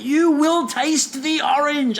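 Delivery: high, slow, wide-eyed intensity; intense. Retold or imitated. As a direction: say it like a fervent promise. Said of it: "got really wide-eyed and intense and went".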